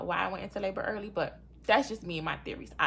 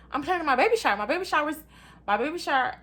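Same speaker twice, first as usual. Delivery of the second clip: indignant protest; 8 semitones higher, 7 dB louder, faster than usual. Retold or imitated. Protesting that babies can't come today.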